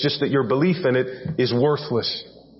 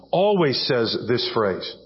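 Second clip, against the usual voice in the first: ordinary delivery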